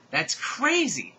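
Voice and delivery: loud, slow drawn-out roar. Acted. Heroine reacting to the news, a slow loud roar. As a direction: roar it out slowly. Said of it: "I roared".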